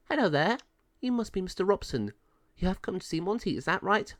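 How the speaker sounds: high-pitched friendly officer's voice; high female character voice; dog pound officer. A narrator's reading. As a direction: pitch it way up as the woman officer greeting a visitor.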